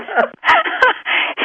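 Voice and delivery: high-pitched giggle; high laugh. Real, not acted. Telling the bangs story to hosts, high giggle.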